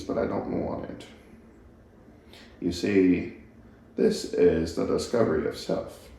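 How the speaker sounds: a hint of a calm, knowing old narrator musing; Morgan Freeman impression. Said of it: "that's my best Morgan Freeman".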